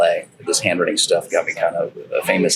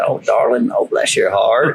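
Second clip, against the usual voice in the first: mom's Texas talk; 3 semitones higher, slower than usual. Retold or imitated. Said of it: "'cause my mom talks like this".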